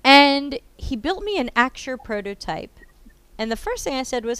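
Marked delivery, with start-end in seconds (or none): none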